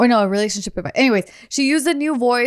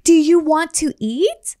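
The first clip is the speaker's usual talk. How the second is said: higher, louder, slow exaggerated parentese; 6 semitones higher, 4 dB louder, slower than usual. Shown as a demonstration. Demonstrating parentese to friends, slow and higher. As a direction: stretch it out for a baby. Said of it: "talking like a baby".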